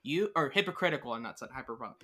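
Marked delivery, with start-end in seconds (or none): none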